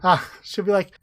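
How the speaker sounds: ordinary talk, nothing marked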